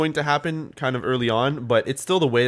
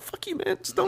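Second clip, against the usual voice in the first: high squeaky fake outrage at a TV show; mock outraged squeak; 5 dB quieter than usual. Friend retelling crying at TV, high mock plea. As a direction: squeal it like you're begging the show to stop.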